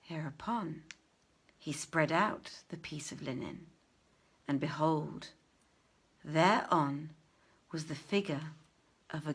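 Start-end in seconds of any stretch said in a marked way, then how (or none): none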